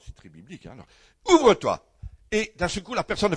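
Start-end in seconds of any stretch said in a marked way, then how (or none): 1.3-1.8 Ephrata, ouvre-toi proclaimed high and loud; loud healing command. Retold or imitated. Preacher retelling his prayer over a deaf woman, loud commanding proclamation. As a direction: command the ears to open with full force.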